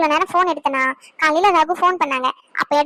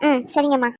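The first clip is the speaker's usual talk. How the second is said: usual speaking voice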